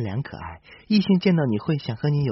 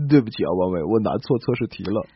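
normal talking voice

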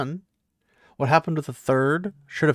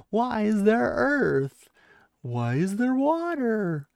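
higher, slow, drawn-out sarcastic questions; incredulous sarcasm; 6 semitones higher than usual. Pretend. Telling Egwene everyone can see the flows, slow and sarcastic. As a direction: spell it out slowly as if to a child. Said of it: "And I was like".

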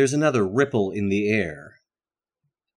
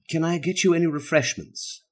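usual speaking voice

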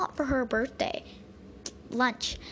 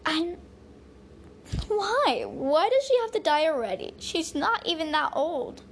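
upset; 5 semitones higher than usual. Acted. Girl upset grandma may die.

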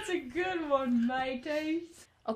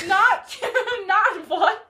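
louder and louder, yelling in confusion; exasperated shouting; 5 semitones higher, 11 dB louder, much slower than usual. Acted. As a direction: blow up at the other caller. Escalating confused phone argument.

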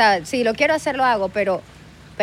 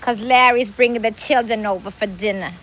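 New York accent; 3 semitones lower than usual. Shown as a demonstration. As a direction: do a drawn-out New York sitcom nanny. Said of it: "Wanna hear New York accent?". (acento judío de Nueva York)

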